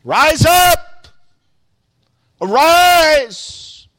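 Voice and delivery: loud, high shouted command; arousing power. Acted. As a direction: shout it to rouse a sleeper, drawn out. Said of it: "an arousing power in that voice".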